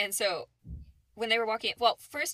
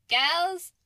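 put-on high, loud call to the girls; 6 semitones higher, 6 dB louder than usual. Mimicking Hannah calling the other women, loud and high. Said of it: "and she's like".

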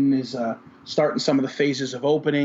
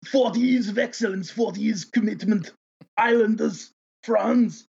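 a higher, hammed-up Franz Nielsen accent; 8 semitones higher, slower than usual. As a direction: ham up Franz Nielsen's Scandinavian-tinged English, pitched high. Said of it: "the Franz Nielsen accent that I've done for ten years".

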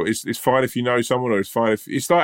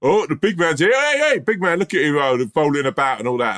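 overfamiliar bloke; 7 semitones higher than usual. Retold or imitated. Overfamiliar guy at brunch teasing the giant, hammed-up. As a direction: ham up a bloke making fun of a big man. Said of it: "his shtick was a bit like".

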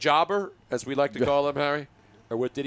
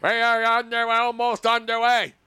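big hockey play-by-play call; booming arena announcer; 7 semitones higher, 4 dB louder than usual. Pretend. Mock arena broadcast opening.

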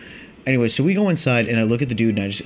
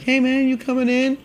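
high, carrying call across the lot; calling out to buddy. Retold or imitated. Calling to his buddy by the car, high carrying call. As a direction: shout over to your friend casually.

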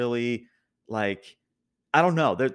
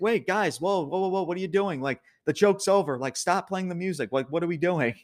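higher-pitched imitated protest; mock-bewildered objection, pitched up; 6 semitones higher than usual. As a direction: act out a wrestler trying to halt things. Acts out wrestler protesting the music.

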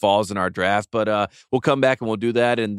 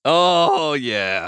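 triumphant cheer; 5 semitones higher than usual. Real, not acted. Host celebrates winning the coin toss, triumphant cheer. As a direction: crow over the win, stretching it out.

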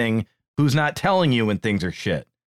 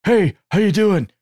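higher-pitched put-on voice for a chummy introduction; mock hearty greeting, lifted above your own voice; John Laurinaitis; 6 semitones higher than usual. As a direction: imitate a colleague introducing himself, pitched up. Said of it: "John Laurinaitis came in and said".

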